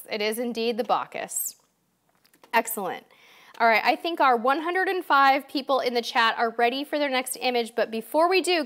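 usual speaking voice

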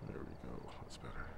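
soft whispered aside. Real, not acted. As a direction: whisper it to yourself. Whispered aside about chat.

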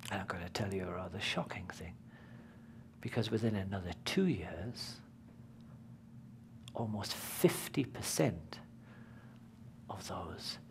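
hushed, quick secret whisper; confiding whisper. Real, not acted. Announcing a shocking fact.

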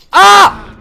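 sudden high scream; pained scream. Real, not acted. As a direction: scream as you get killed. Killed in game.

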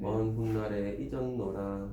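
느리고 낮게 읊는 시구; 받아 적게 천천히 낭송하기. Shown as a demonstration. 받아 적도록 시구를 다시 읊음. (낮은 소리로 길게 읊듯이)

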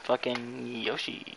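slow, soft try at a cute game-creature voice; Yoshi. Shown as a demonstration. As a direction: stretch the name and let it slide upward. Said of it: "What's your best Yoshi impression?".

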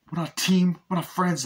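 mock defiant taxi driver; defiant retort. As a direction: throw it back at him. Max finally defying the hitman.

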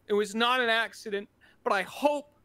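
ordinary delivery

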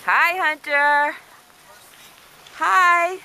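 calling out. Real, not acted. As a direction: call out hi across the slope. Calling out to child on skis.

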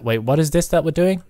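normal talking voice

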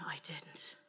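breathless shocked whisper; whispered dread; horrified whisper. Retold or imitated. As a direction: whisper it in disbelief. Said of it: "I'm going".

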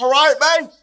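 very loud, high-pitched mock Australian shout; Australian accent. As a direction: yell it as an over-the-top Aussie caricature. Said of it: "That's my Australian accent".

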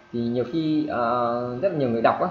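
ordinary talk

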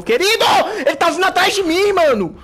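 panicked high-pitched yelling; being chased. Real, not acted. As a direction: shriek in panic while fleeing. Player fleeing an enemy in-game.

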